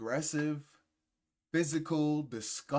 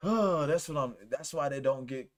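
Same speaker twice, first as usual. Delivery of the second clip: ordinary delivery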